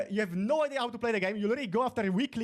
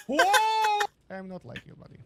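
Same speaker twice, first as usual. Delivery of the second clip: mocking taunt; 12 semitones higher, 4 dB louder, slower than usual.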